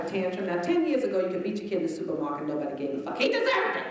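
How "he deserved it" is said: high indignant shout; mock yell. Comic playing a parent in the supermarket, mock yelling. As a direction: bark it like a fed-up parent.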